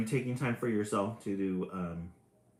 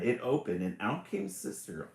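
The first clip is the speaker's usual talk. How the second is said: usual speaking voice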